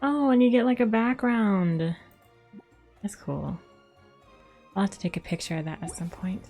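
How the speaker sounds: usual speaking voice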